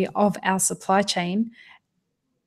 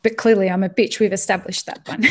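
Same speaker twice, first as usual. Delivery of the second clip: normal talking voice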